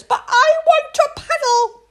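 put-on high loud childish protest; whiny little princess. Princess protests to her parents, high whiny wail.

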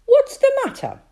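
put-on high, loud child's voice; little girl voice. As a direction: ask like a worried small girl.

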